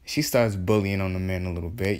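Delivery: usual speaking voice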